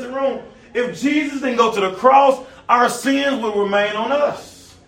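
rising pulpit cadence; preaching. Acted. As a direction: preach it to the pews with fire. Preaching again.

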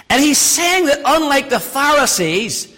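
normal talking voice

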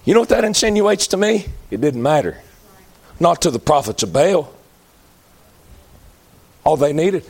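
usual speaking voice

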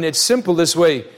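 ordinary delivery

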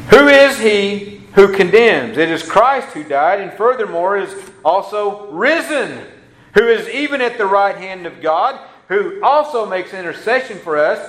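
building, ringing reading; scripture proclaimed. Acted. Pastor reading Romans eight, building fervour. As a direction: declaim the verse with rising fervour.

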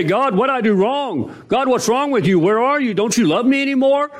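mock complaint to God; lightly voiced desperate prayer; pleading believer.

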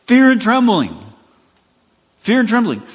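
"fear and trembling" said high and loud; key phrase stressed. Real, not acted. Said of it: "The main thrust is".

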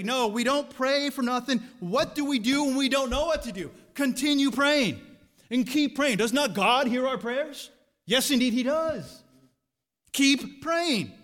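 loud, high, driving sermon voice; full-force preaching. Acted. Preacher urging his church to keep praying, loud and high.

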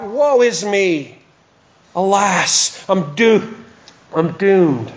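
slow mournful lament; a touch of Isaiah's anguished cry. Retold or imitated. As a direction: cry out in despair, drawn out. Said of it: "he cried out".

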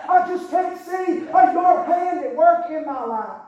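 loud, slowed preaching of the plea; full-force preaching. Acted. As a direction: lean in and preach it.

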